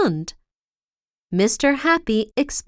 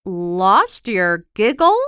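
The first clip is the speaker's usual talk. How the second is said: bewildered; 3 semitones lower, slower than usual. A narrator's reading. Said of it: "he asked in bewilderment".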